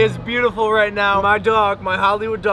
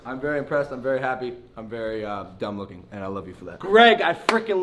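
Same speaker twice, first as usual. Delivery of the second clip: metal over teeth; slight lisp from grill; speaking with grills in; 4 semitones lower than usual. Real, not acted. Seen 4: Grill clearly on teeth before; glints on open mouth during words.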